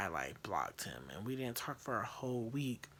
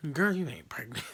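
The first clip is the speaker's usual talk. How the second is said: dismissive mom; 7 dB louder than usual. Retold or imitated. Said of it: "And she was like".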